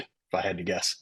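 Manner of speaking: usual speaking voice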